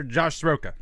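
normal talking voice

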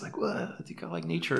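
high, breathy amazed exclaim. Real, not acted. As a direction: sound amazed, almost squeaking. Amazed at green in space.

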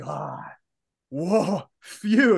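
big, loud "whoa, phew" of relief; acted sigh of relief. Wrestler relives the crowd erupting, acted relief. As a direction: let the tension out in a loud exhale.

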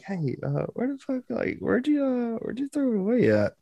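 hesitant, very high, small voice; imitated sheepish pleading. Worker asking coworker about digging through trash, imitated sheepish pleading. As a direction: ask meekly, high and soft, stumbling. Sheepishly asks, feeling like junkie.

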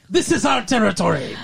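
comic attempt at a Mexican-ish accent; mock mobster accent. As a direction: stake your claim in a silly foreign accent. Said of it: "I don't know why they have that accent".

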